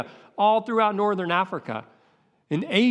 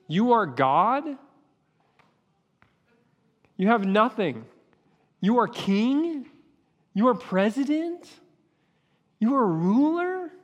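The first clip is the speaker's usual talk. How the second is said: mock their pride, climbing higher each time; rising scorn; 5 semitones higher than usual. Preacher scoffs at proud rulers, building mocking questions.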